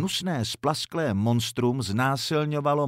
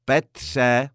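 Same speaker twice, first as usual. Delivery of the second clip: louder, strict motherly call; stern shout; 6 dB louder than usual. A narrator's reading. Mother calling her son in from another room, stern shout. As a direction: call the son sharply by name. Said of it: "křikla Madla přísně".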